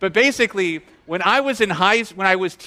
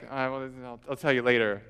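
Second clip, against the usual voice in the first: low, soft, evasive imitation; reluctant undertone; 8 semitones lower, 9 dB quieter, faster than usual. Student dodging his teacher's question, reluctant undertone. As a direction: put them off quietly, keeping it private. Student avoids saying it publicly.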